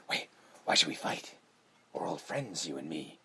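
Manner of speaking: hushed, low imitation of a coaxing villain; low murmured mock plea; soft coaxing villain. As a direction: play the old friend, quietly and low. Freddy coaxing in retold scene.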